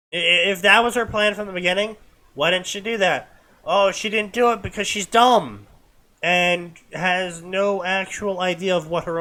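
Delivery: loud, high incredulous complaint; frustrated rant. Real, not acted. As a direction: demand why she didn't just do it. Frustrated rant about character.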